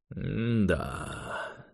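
soft, drawn-out sarcasm; long sardonic hum of doubt; sarcastic drawl. A narrator's reading. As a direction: draw it out quietly, unimpressed. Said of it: "саркастически протянул Юрий".

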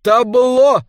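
repeated shout, falling at the end. A narrator's reading. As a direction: call it out again, loud and high. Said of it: "крикнул он". (громкий повторный выкрик)

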